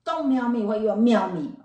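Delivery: loud irritated scolding; upset student scolding the kitty, loud and irritated; annoyed snap. Pretend. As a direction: snap at the cat. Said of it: "today you are not happy".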